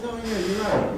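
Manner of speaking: small voice of sudden agreement; persuaded frog. Acted. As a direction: concede lightly as a frog won over. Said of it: "So the frog says".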